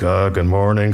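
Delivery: low, embarrassed mumbled-style greeting; sheepish. Retold or imitated. Guest leaving neighbor's house greets wife, sheepish. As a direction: imitate someone caught on the walk of shame. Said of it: "kind of the walk of shame".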